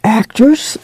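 high, rising note of awe; wondering young woman, pitch lifted; awed question. A narrator's reading. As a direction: ask it in wonder. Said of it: "the girl said in awe".

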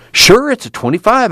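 high, eager exclamation; eager high-pitched call; excited cry. A narrator's reading. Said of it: "Al cried".

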